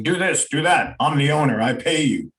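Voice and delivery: mock bossy boss barking orders; bossy dictating owner. Owner dictating to his staff, mock bossy. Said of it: "walk around dictating".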